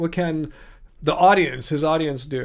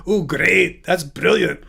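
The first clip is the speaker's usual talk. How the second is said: put-on Scottish accent; slower than usual. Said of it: "so I could do my accent".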